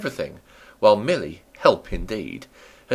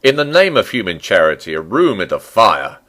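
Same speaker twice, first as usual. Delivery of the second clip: loud, pleading cry; loud plea; 10 dB louder than usual. A narrator's reading. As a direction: beg loudly for a room. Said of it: "he cried".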